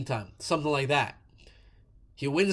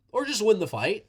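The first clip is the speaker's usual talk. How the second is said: ordinary talk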